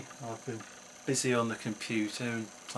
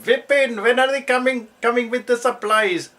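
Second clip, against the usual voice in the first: put-on very high, loud accented shout; calling out; 13 semitones higher, 12 dB louder than usual. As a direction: holler across to the workshop in shopkeeper accent. Shopkeeper calling into the workshop.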